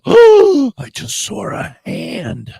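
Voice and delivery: gasp, then slow, high and loud; startled gasp. Real, not acted. Host startled by a hand on a guest's camera, gasping. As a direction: blurt it out as if you jumped.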